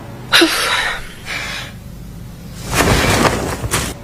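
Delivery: a long unvoiced sigh of worry; long weary sigh. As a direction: let out a heavy sigh, low and long. Worried mother sighs over land.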